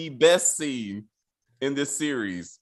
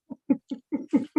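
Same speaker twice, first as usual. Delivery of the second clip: high giggle; 10 semitones higher, 3 dB quieter than usual. Real, not acted. Laughs at his own joke.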